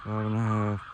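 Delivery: talking through a face mask; muffled speech behind a mask. Real, not acted. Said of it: "Sorry, I'm muffled. I got a mask on".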